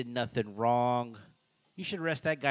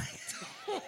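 airy wheezing laugh, way up high; breathless high laugh; 11 dB quieter than usual. Real, not acted. Laughing at his own pimp impression, breathless. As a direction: let a squeaky laugh burst out.

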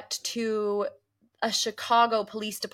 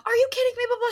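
high loud outraged cry; 7 dB louder, much faster than usual. Retold or imitated. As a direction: burst out in disbelief. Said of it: "instead of being like".

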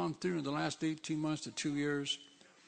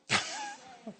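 brief high laugh; a quick high giggle at himself; light laugh. Real, not acted. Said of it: "I feel a song coming on".

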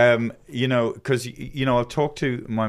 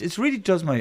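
usual speaking voice

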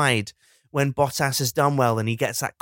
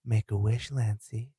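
soft, low and intimate; hushed stepmom; 4 semitones lower, 8 dB quieter than usual. Acted. Stepmom presenting a birthday cupcake, hushed. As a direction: quietly offer the birthday treat.

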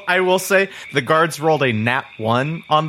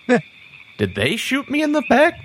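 much higher, puzzled question; dazed confusion; 11 semitones higher than usual. Acted. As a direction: ask it high and bewildered, still dazed. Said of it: "he looks at the guards and he goes".

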